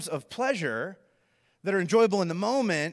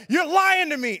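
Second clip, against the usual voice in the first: high, loud imitated accusation; hammed-up furious yell; angry roommate; 10 semitones higher, 5 dB louder than usual. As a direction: call out the lie, outraged. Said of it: "he looks so angry".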